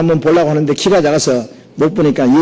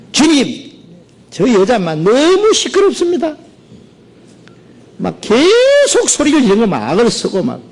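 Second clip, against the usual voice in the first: high, whiny complaint about the noisy woman; fed-up disciples pleading with the master; annoyed disciples; 9 semitones higher than usual. Acted. (높은 목소리로 투덜대는 제자들)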